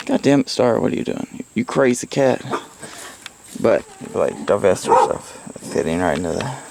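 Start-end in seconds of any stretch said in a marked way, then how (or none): none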